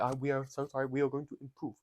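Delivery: lightly voiced Toto Wolff, quiet and apologetic; Toto Wolff impression. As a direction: promise to improve like a sheepish Toto. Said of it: "That's my best Toto impression".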